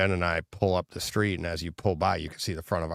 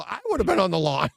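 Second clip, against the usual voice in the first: pitched up and worked up, retelling what he'd have done; telling his co-host he'd have charged the vandals, worked up; high excited retelling; 11 semitones higher, 3 dB louder, faster than usual. Retold or imitated. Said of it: "I go".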